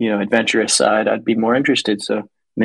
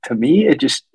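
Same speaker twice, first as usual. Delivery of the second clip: ordinary talk, nothing marked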